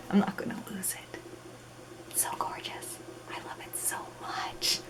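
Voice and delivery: soft adoring whisper. Real, not acted. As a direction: whisper it like a secret love. Hugging book, whispered.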